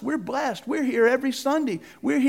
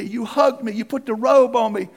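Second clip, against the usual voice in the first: ordinary talk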